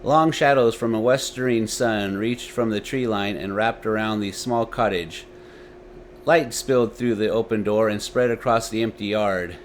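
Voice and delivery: usual speaking voice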